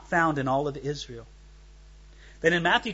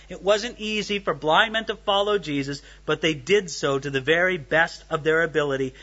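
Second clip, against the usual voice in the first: ordinary delivery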